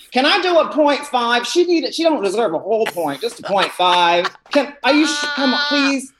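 high-pitched, insistent haggling; pleading. Acted. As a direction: beg for it in a high voice. Acted judge pleading over scores.

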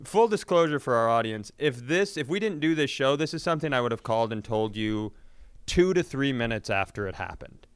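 ordinary talk, nothing marked

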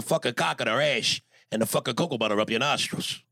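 Tony Soprano impression. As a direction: play the gruff mobster sending congrats on request. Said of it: "can you do me a solid in your Tony Soprano?".

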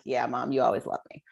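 put-on low, soft, offhand kid voice; a hint of the son. Son answering his mom, low and offhand. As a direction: toss it off like a sulky boy.

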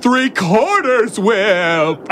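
slow, high, snobby order; snooty customer voice. Retold or imitated. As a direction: drawl it like an entitled customer. Mocking pretentious customer.

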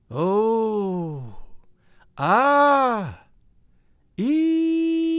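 ghostly voices keening, ending very high; long held notes stepping up; eerie sung vowels. A narrator's reading. Spirit voices sing wordless vowels.

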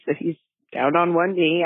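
ordinary talk, nothing marked

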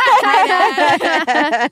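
high, loud, innocent little greeting; sheepish innocent daughter. Retold or imitated. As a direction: play innocent after being caught. Caught daughter greets dad sheepishly.

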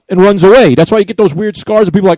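ordinary talk, nothing marked

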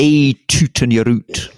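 slow, loud Scottish Presbyterian minister; drawn-out music-hall punchline in a Scottish voice. Retold or imitated. Retelling his father's old music hall joke, Scottish minister voice. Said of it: "the minister's supposed to say".

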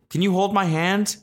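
high-pitched imitation of a pleading kid; timid seatmate. Scared kid asking stranger for his hand, timid high imitation. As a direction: ask small and high, mimicking the kid.

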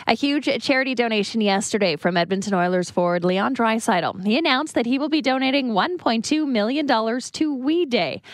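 steady radio news delivery; news read. Acted. Reads the morning news items.

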